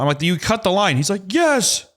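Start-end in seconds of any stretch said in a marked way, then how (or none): 0.2-1.0 high-pitched retold accusation; exasperated accusation. Customer confronting the worker about the line, exasperated. 1.3-1.9 very high-pitched imitated yes; mock worker confession. Trenching worker finally admitting it to customer, mock frantic.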